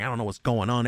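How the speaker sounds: ordinary delivery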